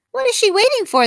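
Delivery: put-on urgent kids' question; anxious children. Children ask each other offstage, high and eager.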